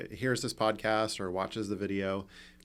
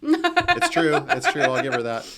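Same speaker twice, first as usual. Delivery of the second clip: laughing; 5 semitones higher, 9 dB louder than usual. Real, not acted. Laughing agreement.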